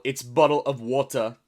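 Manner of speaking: "water" with a British dropped r; mock British accent on "bottle of water". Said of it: "Another one making fun of their accents".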